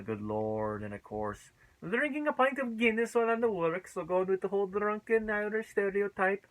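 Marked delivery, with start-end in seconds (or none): 1.8-6.5 high, hammed-up Irish voice; mock Irish accent. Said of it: "that thick Irish accent".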